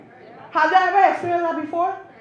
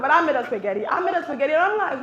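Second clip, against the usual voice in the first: ordinary talk, nothing marked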